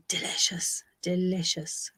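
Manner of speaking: whispered, savouring delicious; hushed relish; whispered delight. Real, not acted. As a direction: breathe delicious out like a treat. Savouring a remembered treat.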